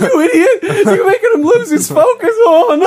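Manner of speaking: very high, loud, cracking scolding; intern monster. Acted. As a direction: scold in a frantic cartoon squeal. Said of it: "like, intern monsters from Monsters, Inc".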